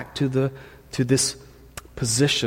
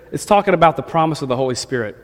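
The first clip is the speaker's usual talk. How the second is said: normal talking voice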